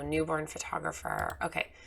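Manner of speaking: ordinary talk, nothing marked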